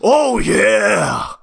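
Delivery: hammed-up mascot shout; mock Kool-Aid Man yell. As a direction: bust through the wall with it. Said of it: "the Kool-Aid man ... says".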